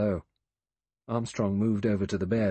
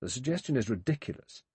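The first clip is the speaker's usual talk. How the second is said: sharply; 7 semitones higher than usual. A narrator's reading. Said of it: "Armstrong said sharply".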